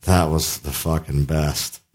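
slow, low, soft imitation of a satisfied wife; mock post-sex praise, drawn out and low; wife's praise. Teasing his friend with the wife's praise, slow and hushed. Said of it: "have to have Rosemary say".

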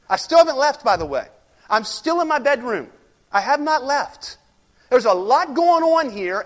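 loud, emphatic sermon delivery; forceful preaching. Acted. Preacher driving the point home to the congregation, forceful. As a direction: preach it hard, punching each line.

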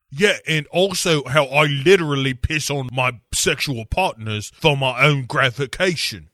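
put-on cockney girl gossip; mock cockney. Spice Girls banter.

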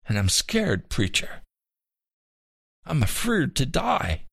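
tearful confession, straining upward; tearful pleading. A narrator's reading. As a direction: voice climbing high in distress. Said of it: "He was openly weeping now".

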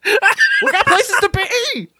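high-pitched laughing squeal, drawn out slowly; squeaky high voice. Pretend. Urging cohost onward in squeaky high voice.

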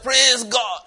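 hammed-up high churchgoer shouting praise, last words dragged out slowly; mock the gullible believer at full cry; high, loud parody of a shouting worshipper. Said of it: "The man will now go to church".

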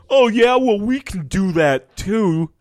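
high, loud whiny comeback; mock defensive guy. Mocking a misogynist replying on Twitter, high and whiny. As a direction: sneer out a petty retort. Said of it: "Yeah, like".

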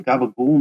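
gabagool said the South Philly way, hard g and clipped ending; South Philly Italian. Shown as a demonstration. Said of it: "add in a little bit of, uh, the Italian in it".

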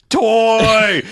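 put-on loud, high, drawn-out kid's cry; greedy excitement. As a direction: blurt it like a dog spotting steak. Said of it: "like a dog seeing a steak".

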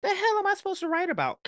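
high, squeaky panicked question; mock alarm; retold panic. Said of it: "And then I was just like".

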